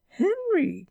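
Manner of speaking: high, loud call of his name; high-pitched scolding call; shocked cry. A narrator's reading. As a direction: cry out in reproach. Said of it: "cried Missus Brown".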